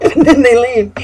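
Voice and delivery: said through high laughter; can't keep from laughing at them. Real, not acted.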